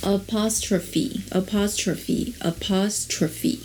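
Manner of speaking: apostrophe repeated slowly, syllable by syllable; careful repetition. Shown as a demonstration. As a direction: practise apostrophe slowly three times. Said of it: "是这样吗？".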